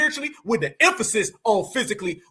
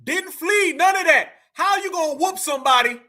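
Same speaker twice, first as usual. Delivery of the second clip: hyped up; 6 semitones higher, 4 dB louder than usual. Real, not acted. Hyped co-host backing the point.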